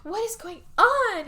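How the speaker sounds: a hint of an alarmed wife; wife seeing her husband stumble home, concerned; worried wife. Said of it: "and Emma's like".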